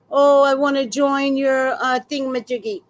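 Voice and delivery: mock broken English. Mocking the scammer's broken English, high and loud. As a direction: sneer like a clumsy scammer. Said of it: "you do broken English".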